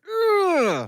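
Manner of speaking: very high exasperated groan; a squeaky fed-up groan; exasperated ugh. Retold or imitated. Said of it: "and I'm like".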